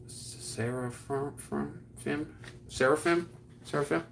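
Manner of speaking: "Seraphim" drawn out slowly, then asked again quickly; "Seraphim" read off unsure; sounding out name. Shown as a demonstration. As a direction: test "Seraphim" with a questioning rise. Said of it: "what does it say".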